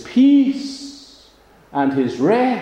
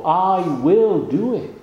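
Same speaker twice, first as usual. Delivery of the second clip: "will" raised, "I" leaned on; emphatic I; 5 semitones higher, 4 dB louder than usual. Shown as a demonstration. Preacher repeating Joseph's promise, stressing the "I". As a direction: press "I will" with rising tune. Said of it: "here is emphatic".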